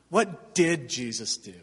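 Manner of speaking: "did" punched in a falling question; stress on DID. Shown as a demonstration. Preacher correcting the bracelet's question, stress on DID. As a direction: hit the word "did", then let the rest drop. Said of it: "it emphasizes your works".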